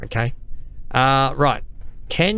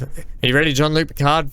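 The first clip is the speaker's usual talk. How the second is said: ordinary talk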